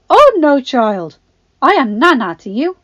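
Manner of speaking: loud, high opening, grandmotherly correction; old nana. A narrator's reading. Agatha interrupts Belle to correct her, grandmotherly. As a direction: cut in fussily as an old woman.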